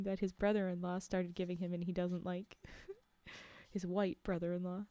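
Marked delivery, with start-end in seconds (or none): none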